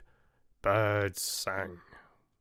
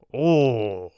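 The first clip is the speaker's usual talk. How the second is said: rapturous; 5 dB louder, much slower than usual. A narrator's reading. Old oak's rapturous final words.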